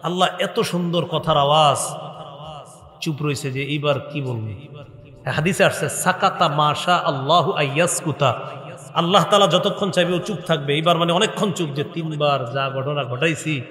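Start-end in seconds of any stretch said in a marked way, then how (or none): none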